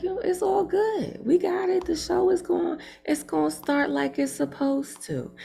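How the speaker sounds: high-pitched, hyped-up approval; excited group reaction. Retold or imitated. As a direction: voice the crowd's delight way up high. Host acts out the delighted audience.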